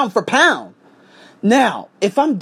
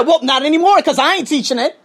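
high-pitched, defiant retort; defiant refusal; 8 semitones higher than usual. Retold or imitated. Teacher refusing to take the class, defiant. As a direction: refuse point-blank, pitch raised.

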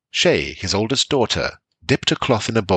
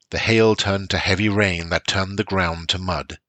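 plain reading voice

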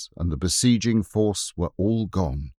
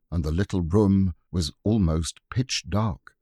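plain reading voice

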